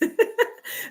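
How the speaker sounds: squeaky little laugh; high breathy giggle. Real, not acted. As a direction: giggle at her own joke. Giggle after herbs joke.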